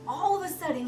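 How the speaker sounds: usual speaking voice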